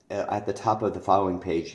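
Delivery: ordinary talk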